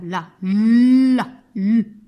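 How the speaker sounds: "la" loud, its l held long, then bare "lu"; initial-sound demonstration. Shown as a demonstration. Teacher making children hear the L, "la" with a long loud l. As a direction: linger on the l of "la" loudly. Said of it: "j'entends L".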